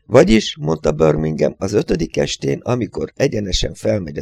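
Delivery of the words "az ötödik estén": usual speaking voice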